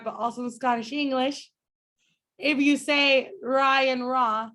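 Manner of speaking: usual speaking voice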